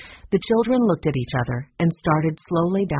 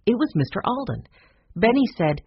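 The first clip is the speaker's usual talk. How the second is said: plain reading voice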